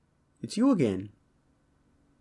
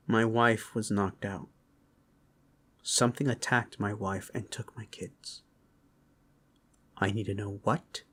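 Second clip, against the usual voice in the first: low, hushed and grim; grave plea; 4 semitones lower, 6 dB quieter than usual. Acted. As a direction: drop to a near-whisper, holding back the anger. Father recounts attack, pleads for help.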